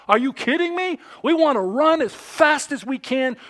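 loud, high-pitched disbelief; incredulous outburst. Acted. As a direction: scoff at the very idea, loudly. Mock outrage at being told relax.

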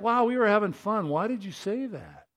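high-pitched mock dismay; dismayed protest. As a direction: protest as if the party was just spoiled. Acted protest after a bombshell.

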